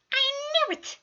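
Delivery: high, whispered screech. A narrator's reading. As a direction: hiss the triumph in a high whisper. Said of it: "he screeches".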